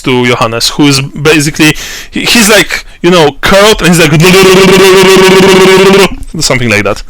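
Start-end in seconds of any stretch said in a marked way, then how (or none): 4.2-6.4 rapid, higher-pitched gibberish babble; long run of rolling nonsense syllables; mad babbling. Acted. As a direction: gibber nonstop like a man gone insane. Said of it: "babbling something about huge lizards".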